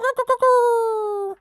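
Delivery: high, loud rooster crow, a little shorter; a loud rooster crow, clipped shorter; second rooster crow. Acted. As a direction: crow again at full voice. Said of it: "cried out his loudest".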